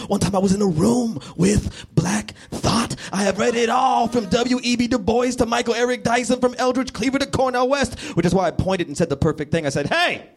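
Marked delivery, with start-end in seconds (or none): 0.1-8.0 frantic boasting. Acted. Frantic inner monologue, building rant. 9.9-10.3 high, sharp call-out; sudden high "hey" to stop someone. Acted. Calls out the perfect comeback.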